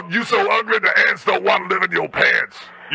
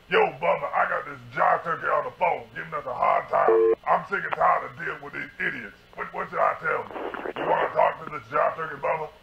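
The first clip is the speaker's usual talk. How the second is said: softer, lower aside to a buddy; aside off the phone; 4 semitones lower, 8 dB quieter than usual. Acted. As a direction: talk away from the receiver. Turns from phone to Bubba.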